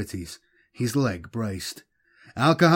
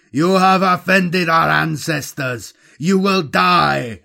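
shouted back; 5 semitones higher, 7 dB louder than usual. A narrator's reading. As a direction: shout it at the enemy in battle. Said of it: "Al Kahan shouted back".

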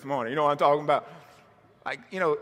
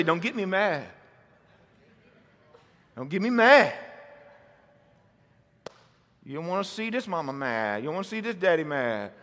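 lower, softer mock threat to a child; a hint of a fed-up parent; stern parent warning; 8 semitones lower, 6 dB quieter, faster than usual. Congregation told to warn a neighbor, a hint of a stern parent. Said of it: "Now look at him and say".

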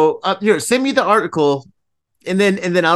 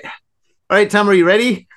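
normal talking voice

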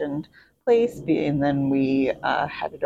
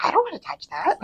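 much higher mock-disgusted mother voice; disgusted mom; 13 semitones higher, faster than usual. Mom refusing her sick daughter's tissues, squeamish high voice. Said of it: "her mom's like".

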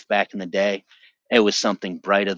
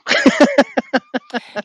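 high laugh; 9 dB louder than usual. Real, not acted. Laughs after pseudonym.

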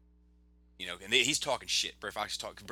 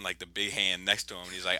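normal talking voice

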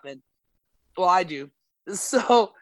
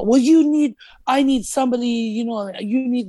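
higher, louder mocking voice of the wife; mock the pushy wife, pitched up and loud; mimicking the wife; 5 semitones higher, 4 dB louder than usual. Wife suggests she needs a man.

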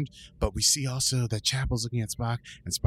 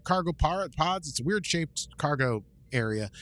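ordinary talk

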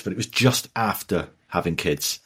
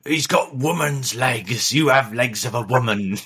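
West Country impression. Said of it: "The, the guy from Blackadder".

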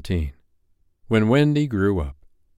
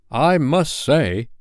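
higher, drawn-out grudging remark; 6 semitones higher, 3 dB louder, much slower than usual. A narrator's reading. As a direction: say it slowly and pointedly, begrudging. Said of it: "a grudging remark".

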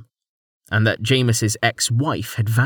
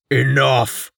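loud commanding roar; 3 semitones higher, 3 dB louder than usual. A narrator's reading. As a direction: silence the crowd with one word. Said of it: "Rain roared".

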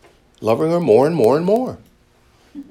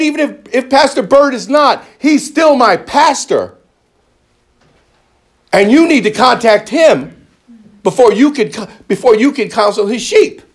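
retold fury; 8 semitones higher, 5 dB louder than usual. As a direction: replay the angry confrontation with the counselor, much higher and louder. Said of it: "I was furious with him".

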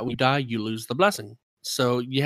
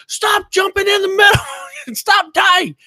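high, loud exasperated shout with a laugh in it; imitated angry yelling; 6 dB louder than usual. Raider yelling at teammates down in the middle, exasperated shout. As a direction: yell it like a fed-up teammate, half laughing. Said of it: "he was yelling at this other guy".

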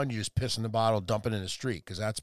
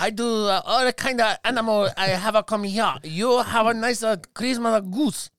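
put-on higher-pitched, halting caricatured Chinese accent; mock Chinese accent; 7 semitones higher than usual. Emperor inviting the animals, mock Chinese accent. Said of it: "can you do a Chinese accent?".

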